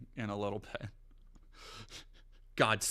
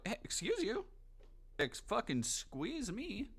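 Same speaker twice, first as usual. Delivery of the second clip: high, slow, prissy mock offense; mock offended squeak; 11 semitones higher, 4 dB quieter, slower than usual. Real, not acted. Streamer scolding the cat, high mock-offended squeak. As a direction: play the offended diva.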